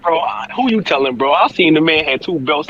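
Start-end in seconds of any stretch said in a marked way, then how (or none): none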